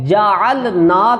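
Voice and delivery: جعلنا with the lam silent, ja'l-na; جعلنا said with a jazm on lam, slow; wrong reading. Shown as a demonstration. Teacher showing students the mistaken reading, slowly. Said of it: "میں اس کو کیا کرتا ہوں".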